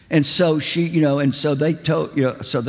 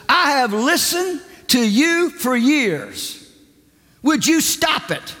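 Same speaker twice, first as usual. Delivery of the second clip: high, slow scolding, each word pressed; a pastor who has had enough; stern rebuke; 10 semitones higher, slower than usual. Retold or imitated. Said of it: "he said".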